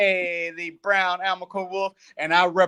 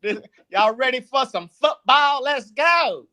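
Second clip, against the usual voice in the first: laughing imitation of her country hype; mock country twang; 5 semitones higher than usual. Teasing his co-host by parroting her, in a mock country twang. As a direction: poke fun at her twang, a bit higher. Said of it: "that thing sounds so country and lovely".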